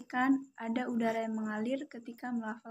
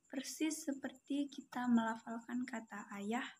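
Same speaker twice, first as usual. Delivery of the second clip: ordinary talk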